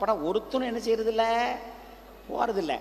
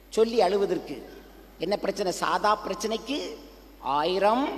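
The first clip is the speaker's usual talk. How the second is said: ordinary talk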